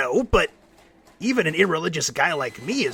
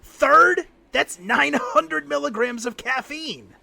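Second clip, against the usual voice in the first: shocked disbelief; 10 semitones higher, slower than usual. Acted. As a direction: react in alarm at the number. Shocked at caffeine amount.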